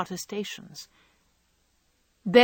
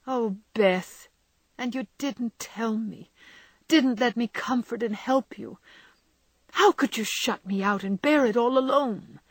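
tender reproach; 5 semitones higher, 3 dB louder than usual. A narrator's reading. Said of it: "Jo's voice was full of tender reproach".

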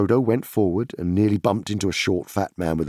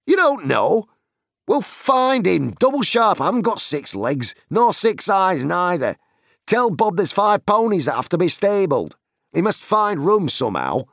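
high, raised-voice landlord giving orders; exasperated innkeeper; 13 semitones higher, 5 dB louder than usual. A narrator's reading. Landlord sending Nob to find the stable hand, exasperated. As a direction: scold and order the servant about.

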